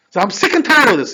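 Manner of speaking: loud, high acted frustration; frustrated. Husband complains to his wife about the rabbi, frustrated. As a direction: vent like someone at the end of his rope. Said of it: "he got frustrated".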